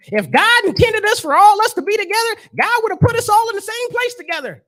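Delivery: high, shouted Southern bigot rant; mock Southern accent. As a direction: ham up the Southern Klan guest yelling. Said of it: "Excuse me if I do the accent".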